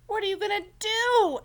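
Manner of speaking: high, loud worried question; Beaver's voice. Acted. Beaver asking Franklin, high and loud.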